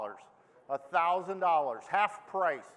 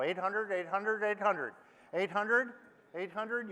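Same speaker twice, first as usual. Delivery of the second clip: auctioneer; 3 dB quieter than usual. Acted. Auctioneer bid calling.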